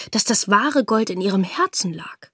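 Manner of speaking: ordinary delivery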